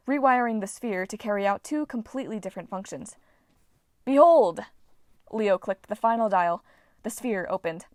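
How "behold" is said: triumphant. Acted. Leo's triumphant reveal.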